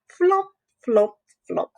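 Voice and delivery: hop each flop like a frog; hopping sounds. Acted. Saltitos de rana imitados. (saltitos de rana, agudos)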